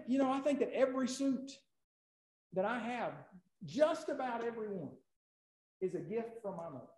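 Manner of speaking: usual speaking voice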